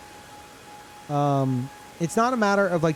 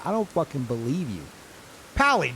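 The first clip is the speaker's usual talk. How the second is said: usual speaking voice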